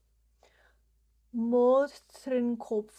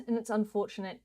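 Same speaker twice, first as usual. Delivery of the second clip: ordinary talk, nothing marked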